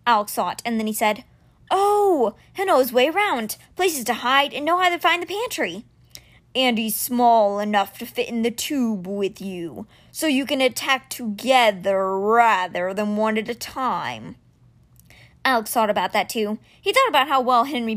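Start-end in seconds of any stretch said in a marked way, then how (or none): 1.7-5.8 light up as it clicks; realizing boy Alex. Acted. Boy seeing why Henry fits, excited. 6.6-14.4 very slow, grave creature voice; measured like a great stone beast; stone cat Simber. Acted. Stone guardian bringing news to Alex, slow and grave.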